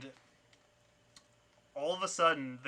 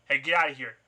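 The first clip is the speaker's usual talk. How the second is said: loud, sharp acted order; thug's raised-voice threat; hostile warning; 9 dB louder than usual. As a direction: warn off an intruder forcefully. Knifeman warns off the newcomer.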